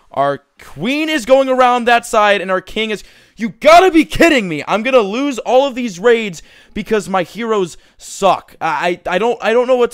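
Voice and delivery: high, loud yelling in disbelief; a gamer losing it at his heroes; exasperated yell. Real, not acted. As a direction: shout it at the heroes going astray. Heroes going wrong way.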